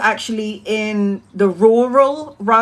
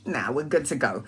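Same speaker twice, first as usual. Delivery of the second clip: low, soft offhand brush-off; casual dismissal; 8 semitones lower, 9 dB quieter than usual. Retold or imitated. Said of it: "And I was like".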